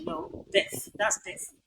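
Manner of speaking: normal talking voice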